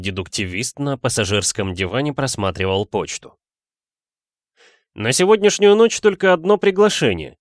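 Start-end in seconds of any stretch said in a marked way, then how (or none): 4.9-7.4 high, loud sustained shout; shouting over noise. A narrator's reading. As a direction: call out to a servant over an engine. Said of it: "прокричал он Фирсу". (громко прокричать)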